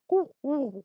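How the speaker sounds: high hoots and tweets; imitated bird sounds. Bird answers a football interviewer, high imitated hoots and chirps. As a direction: answer in assorted bird noises. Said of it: "a bunch of different bird sounds".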